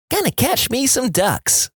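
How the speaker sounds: put-on high, slow country kid. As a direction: drawl it like a little kid. Kid's reply, voiced.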